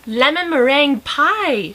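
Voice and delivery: delighted exclamation. A narrator's reading. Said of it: "she exclaimed".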